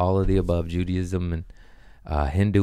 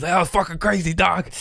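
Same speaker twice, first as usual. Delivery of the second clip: hooting excitedly; 11 semitones higher than usual. Retold or imitated. Shouting to the shaman mid-ceremony, hooting excitedly. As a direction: holler it like you're blown away. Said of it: "hooting and hollering".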